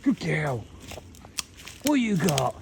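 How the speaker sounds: high sing-song dog praise; praising the dog. Real, not acted.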